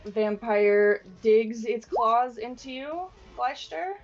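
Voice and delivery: normal talking voice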